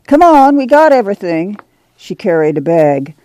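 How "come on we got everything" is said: calling out. A narrator's reading. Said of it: "Ma called".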